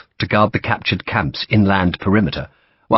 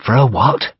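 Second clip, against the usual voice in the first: ordinary delivery, nothing marked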